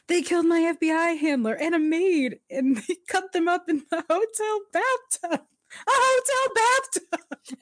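very high, hammed-up horrified retelling; mock outrage. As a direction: imitate a scandalised outburst, stretching the last phrase. Retold horrified outburst of Culpepper.

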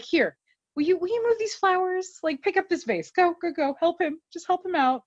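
a busy priest shooing helpers along; mock priest. Nate as priest putting thugs to work, high fussy voice.

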